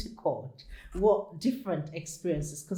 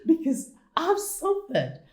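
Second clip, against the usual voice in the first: tearful, pitched high and cracking as she recalls the abuse; real tears breaking through the words, high and strained; crying; 7 semitones higher, 5 dB louder than usual. Real, not acted. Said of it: "I bawled".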